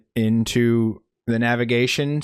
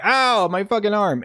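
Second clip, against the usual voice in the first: mock pain; 9 semitones higher than usual. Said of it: "Brother's like".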